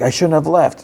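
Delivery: retold panicky regret, pitched high; mock freaking out. Solo locked out of the vault, mock freaking out. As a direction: imitate him fretting, voice lifted high. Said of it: "he's, like, freaking out".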